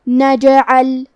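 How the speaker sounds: نجعل with the ج bounced; qalqalah demo. Shown as a demonstration. Teacher demonstrating qalqalah on نجعل. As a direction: echo the ج in نجعل. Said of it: "ہلاتے ہوئے یہ کلمہ کو پڑھیں گے".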